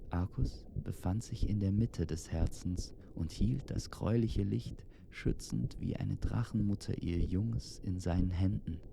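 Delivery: usual speaking voice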